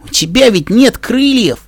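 a pegasus stunned her friend claims wings; bewildered outcry. Acted. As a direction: stretch "нет" high in disbelief. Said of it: "растерянно протянула Пегаска".

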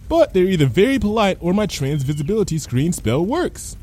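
lightly voiced blithe character; Lyra, unworried. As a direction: reason it out cheerfully. Lyra reasons on.

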